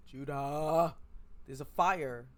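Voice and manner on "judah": louder call of the name. Acted. Character calling to Judah, louder. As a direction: call the name across the room.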